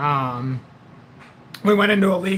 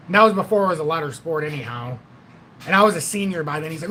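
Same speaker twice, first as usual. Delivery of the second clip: ordinary delivery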